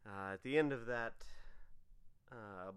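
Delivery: ordinary talk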